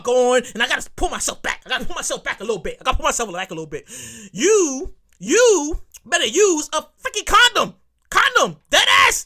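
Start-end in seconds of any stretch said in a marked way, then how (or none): 4.3-8.6 loud. Real, not acted. Ranting at pregnant friend. 8.7-9.2 mock New York slang; New York accent. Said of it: "In my New York voice".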